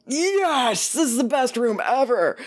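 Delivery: high-pitched gleeful cheer; thrilled. Retold or imitated. Housekeeping girls getting a private bathroom, thrilled.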